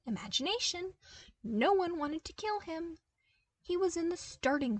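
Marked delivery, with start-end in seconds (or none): none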